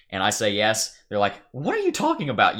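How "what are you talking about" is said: high, loud mock disbelief; incredulous mock disbelief. Streamer imitating a friend's disbelief, high and loud. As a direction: scoff like a doubting friend. Friends scoffing at his answer.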